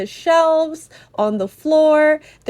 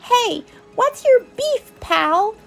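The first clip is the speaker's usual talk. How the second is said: voicing Goombella; 5 semitones higher than usual. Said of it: "Goombella says".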